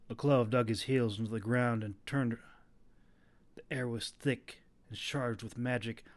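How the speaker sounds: usual speaking voice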